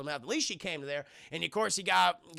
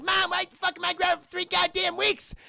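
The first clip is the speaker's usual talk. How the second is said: high, loud whining protest; mock outraged child; 12 semitones higher, 6 dB louder than usual. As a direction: shout back as an imitated indignant kid. Acted child protesting punishment.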